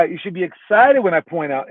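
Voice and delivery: ordinary talk, nothing marked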